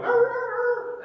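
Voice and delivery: very high mock yelp and snarl; hammed-up dog noise; imitated dog snarl. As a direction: bark back like the little dog. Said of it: "and the dog went".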